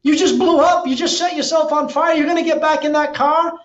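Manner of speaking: shocked onlooker asking in high, quick disbelief; incredulous exec. Retold or imitated. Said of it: "And he went".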